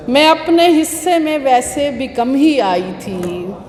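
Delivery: slow, measured mushaira delivery; Urdu poetry recital. Acted. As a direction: declaim each half-line, then let it hang. Recites her ghazal.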